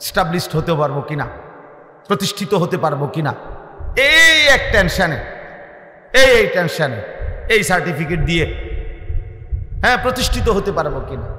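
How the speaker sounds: high, forceful sermon with surging peaks; impassioned preaching. Real, not acted. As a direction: preach it hard, rising to loud cries, then dropping back. (আবেগভরা জোরালো ওয়াজের কণ্ঠ)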